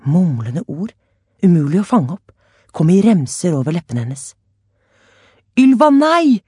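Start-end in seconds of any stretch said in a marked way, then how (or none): 5.6-6.4 high, loud alarmed cry; shouting. A narrator's reading. Haimo crying out to Ylva, alarmed shout. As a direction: stop her before she reaches the flames. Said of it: "ropte Haimo".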